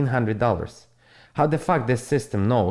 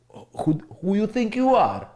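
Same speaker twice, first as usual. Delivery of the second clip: higher, affronted challenge to a rude viewer; offended talk-back, pitch raised; indignant retort; 6 semitones higher than usual. Acted. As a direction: put the disrespectful commenter in his place.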